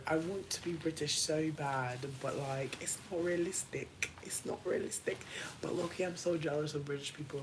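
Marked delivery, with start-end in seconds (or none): 4.2-5.2 whisper. Real, not acted. Repeated, whispered. 5.6-7.4 quick confiding whisper; fast secretive whisper. Real, not acted. Confiding jealousy.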